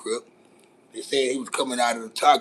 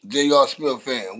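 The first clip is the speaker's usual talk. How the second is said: normal talking voice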